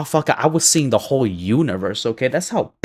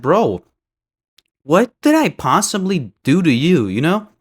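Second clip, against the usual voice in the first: retold angry confrontation; 5 semitones higher, 3 dB louder than usual.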